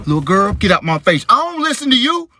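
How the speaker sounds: put-on scolding the little girl, high and loud; annoyed dad. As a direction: snap at the kid.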